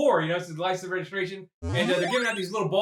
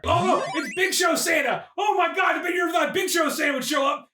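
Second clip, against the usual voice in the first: big hammed-up exclamation, pitched high; mock-excited announcer shout; 6 semitones higher than usual. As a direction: hype up a giant surprise entrance. Acting out Big Show Santa's entrance.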